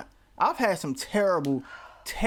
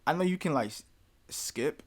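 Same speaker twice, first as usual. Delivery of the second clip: normal talking voice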